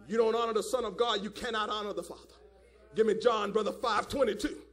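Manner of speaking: ordinary delivery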